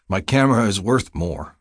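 ordinary delivery

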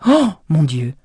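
shocked cry at the sight of the fire; stunned, loud oh my God; cry out in alarm. Acted. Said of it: "s'écria-t-il à voix haute".

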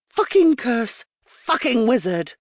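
cursing. Acted. Sophie cursing the wizard while plucking hairs, frustrated. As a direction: spit the curses at the mirror.

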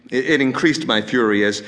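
usual speaking voice

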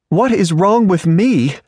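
high, sharp woman's retort; indignant retort. A narrator's reading. Caroline throws the question back at Reardon, indignant and high. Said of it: "she retorted".

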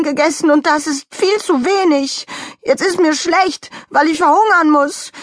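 high, wounded loud protest; upset outcry. Acted. Donald Rabbit saying he will starve, loudly protesting. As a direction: protest as the wronged one. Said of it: "rief Donald Rabbit".

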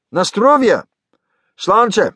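high, exclaimed drinking toast; hearty toast. Acted. Greg and Russian researchers toast each other, hearty call.

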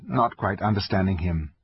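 ordinary delivery, nothing marked

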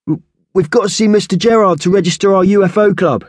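high, eager schoolboy voice, stumbling on the first word; a boy blurting out his excuse; stammering boy voice. Acted. Eager boy blurting out an excuse, high and stammering. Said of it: "boy cuts in, stammering".

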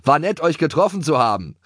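high, cheeky parting call; called-out farewell. A narrator's reading. Schoko to the rival horses, called farewell. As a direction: call it back to those left behind. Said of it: "rief Schoko den Donnerheini-Pferden zu".

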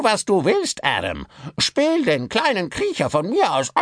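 high rasping puppet tirade; malicious dummy mocking its master; ranting puppet. Puppet rants against Pollock. (boshafte Puppe schimpft)

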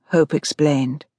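ordinary delivery, nothing marked

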